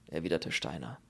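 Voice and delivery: usual speaking voice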